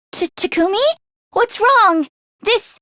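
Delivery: normal talking voice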